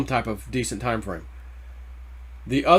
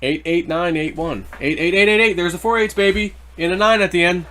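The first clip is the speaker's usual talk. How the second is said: excited, raised-pitch number calling; horse race announcer; 4 semitones higher, 3 dB louder than usual. Real, not acted. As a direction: rattle off the numbers like a race caller. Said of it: "the announcer of a horse race".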